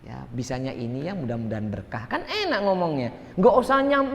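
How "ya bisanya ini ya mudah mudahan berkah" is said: softer, modest, content answer; quiet humble alhamdulillah reply; humble grateful reply. Retold or imitated. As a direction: deflect the jab gently with gratitude. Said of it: "Apa kita jawab kalau gitu".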